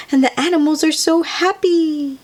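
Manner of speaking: ordinary delivery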